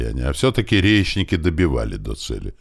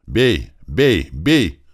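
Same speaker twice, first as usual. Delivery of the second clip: short, raised, separate calls; repeated firing commands; 3 semitones higher, 3 dB louder, much slower than usual. A narrator's reading. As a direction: call the order from tower after tower. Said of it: "слышались команды с башен". (отрывистые команды стрелять)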